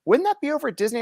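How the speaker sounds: mock confused cashier asking; imitating clerk. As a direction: play the baffled clerk, pitch up. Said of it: "She's like".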